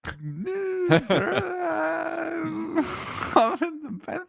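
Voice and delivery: high, far-off laughing squeal; laughing shriek. Real, not acted. Caster squealing with laughter at compliment.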